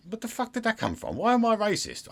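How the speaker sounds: high shocked protest; outraged disbelief. Retold or imitated. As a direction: yelp it in disbelief. Acted outrage at accusation.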